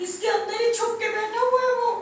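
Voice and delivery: high, insistent pointing-out; tattling rabbit. Acted. Rabbit shows the king where the rival hides, urgent.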